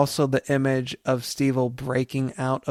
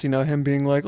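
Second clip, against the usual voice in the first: usual speaking voice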